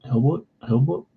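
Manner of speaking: Hilboit, er said as oi; can't say R's. Retold or imitated. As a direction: Hilboit with no R, oi vowel. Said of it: "I would say Hilboit".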